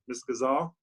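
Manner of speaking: ordinary talk, nothing marked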